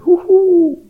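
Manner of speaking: high, loud owl hoot; long, swooping owl call. A narrator's reading. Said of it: "heulte die Eule".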